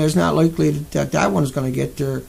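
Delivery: ordinary talk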